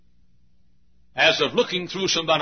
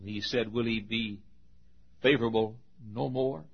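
hushed lament; 4 semitones lower, 10 dB quieter than usual. Acted. Asaph's lament, dropped low.

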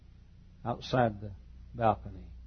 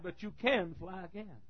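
softer, slow, tender lean on can; soft promise; 5 semitones higher, 9 dB quieter than usual. Acted. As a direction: drop the voice, slowly. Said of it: "And he said".

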